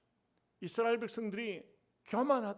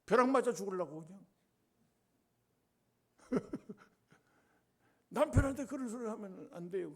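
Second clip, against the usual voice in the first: ordinary talk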